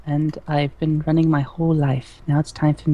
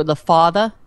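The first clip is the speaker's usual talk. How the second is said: light southern Boston drawl on "father"; southern Boston accent; 5 dB louder than usual. Retold or imitated. To friends, imitating her father's region, light southern Boston accent. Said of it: "So it's like they go".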